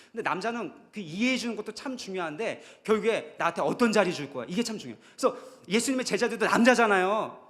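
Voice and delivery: loud, high-pitched sermon push; full-force preaching; impassioned preaching. Real, not acted.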